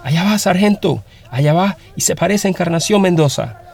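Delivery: high, urgent call across a distance; full-lunged shout. Acted. Peon spotting the fugitive from afar, shouting high to the sergeant. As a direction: holler the sighting to the sergeant, pitched high. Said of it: "una voz gritó a todo pulmón".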